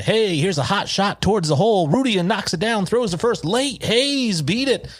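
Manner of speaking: high-pitched baseball play-by-play impression; Harry Doyle. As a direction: imitate a radio announcer calling the play, very high. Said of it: "the call is".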